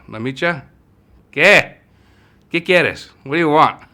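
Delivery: high, loud impatient grandma voice; grandma's sharp reply. Retold or imitated. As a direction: bark back like a stern old grandma.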